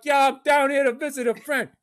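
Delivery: slightly high, innocent reply; a hint of eager out-of-towner; naive newcomer. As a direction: lightly play the gullible visitor. Naive self answering the cabbie.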